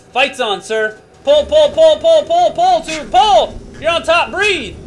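loud, high urgent commands; shouting coach. Real, not acted. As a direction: drive him hard over the noise. Coach shouting during g-force run.

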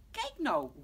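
loud, high exclamation; calling out. Acted. Pap spots the missing tutteltje, calling out. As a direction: call out at a sudden discovery. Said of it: "roept pap".

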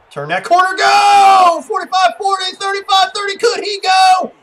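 high, very loud play-by-play cheer; excited shout. Real, not acted. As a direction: shout it as the runner breaks free. Cheering on a breakaway run.

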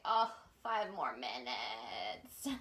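low, slow sleepy voice; sleepy groan. Pretend. Sleepy morning voice joke.

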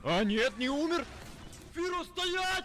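high excited yell; shouting. Acted. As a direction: shout after her. Dub, yelling at Filo.

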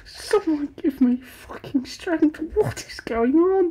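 breathy whispered plea for patience; exasperated whisper. Real, not acted. Reaction streamer whispering in disbelief at the video. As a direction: whisper it, at the end of your rope.

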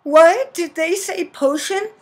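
high startled questions with a loud first word; alarmed surprise. Acted. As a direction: play a frightened heroine overhearing something. Heroine overhears mention of potion.

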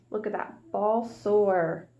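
ordinary delivery

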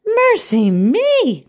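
high, loud, slow exclamation of shock; shocked cry. A narrator's reading. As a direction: gasp it out in shock. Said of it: "Miss Mingo cried".

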